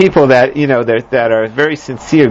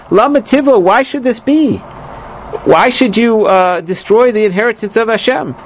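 guilt trip; 6 semitones higher than usual. Retold or imitated. Wise woman guilt-trips the general into mercy. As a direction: make him feel guilty about the city. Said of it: "So she throws ... a guilt trip".

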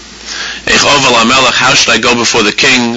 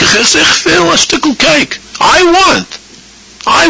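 put-on very high, drawn-out childish whine for cake; greedy craving; much slower than usual. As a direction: whine "I want, me, me" like a spoiled child. Mocking the body's craving.

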